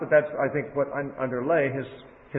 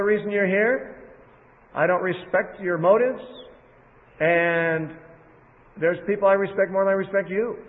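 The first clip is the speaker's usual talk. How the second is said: firm rebuff; 4 semitones higher than usual. Retold or imitated. Preacher voicing Jesus rebuffing family.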